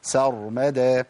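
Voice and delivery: very slow سر مدى with a short, light س; correct slow. Shown as a demonstration. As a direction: sound out سر مدى without an extra vowel. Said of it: "ما ينفعش".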